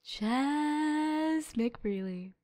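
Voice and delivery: very slow, soft, dragged-out name; drawn-out disappointment. Real, not acted. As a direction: let the name sag in dismay.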